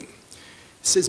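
ordinary talk

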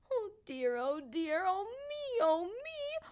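high, mournful lament; sad turkey. A narrator's reading. Turkey sadly answering the frog, high and mournful. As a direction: moan it like a sad little turkey. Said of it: "Timmy sadly replied".

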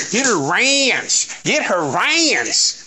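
mock Southern drawl, slow and high; lightly hammed-up Southern ranch; imitating southern accent. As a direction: stretch ranch out Southern style. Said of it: "You and that southern accent".

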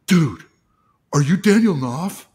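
loud, eager fan voice; excited fan. Retold or imitated. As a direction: call out like a starstruck fan. Fans approach writer.

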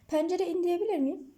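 usual speaking voice

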